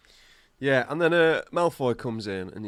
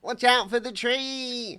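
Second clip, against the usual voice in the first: film quote voice; 11 semitones higher than usual. Retold or imitated. Quoting a film line.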